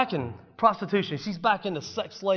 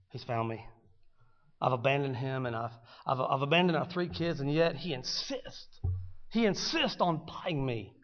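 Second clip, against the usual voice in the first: ashamed murmur; 2 semitones lower, 7 dB quieter than usual. Acted. Gomer's inner voice, ashamed.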